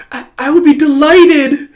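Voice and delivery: high, loud squeal of acceptance; stammer, then burst out delighted; delighted girl. Acted. Girl voiced accepting invitation.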